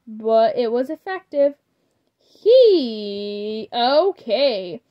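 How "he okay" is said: loud exasperated giving in; exclaimed. A narrator's reading. Father finally giving in to the girls, loud exasperated exclaim. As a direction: throw up your hands and cave loudly. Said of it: "he exclaimed".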